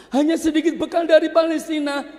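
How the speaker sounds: quick high grumble; complaining wife. Acted. Wife complains to husband, high and fast.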